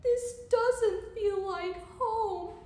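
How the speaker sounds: high, drawn-out storybook wail; hammed-up, upset little outburst; crying out. A narrator's reading. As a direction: lament it loudly, stretching the words. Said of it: "And he cried".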